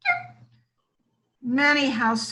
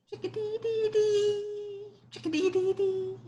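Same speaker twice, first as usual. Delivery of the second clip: high, soft chickadee chirp; chickadee call; 9 semitones higher, 4 dB quieter than usual. A narrator's reading. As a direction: chirp the name like a little chickadee. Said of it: "Some birds say their name".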